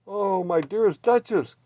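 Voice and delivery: high, loud overjoyed cry; an owner finding her lost cats; relieved cry. A narrator's reading. Madame finding her cats safe, high relieved cry. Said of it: "cried Madame".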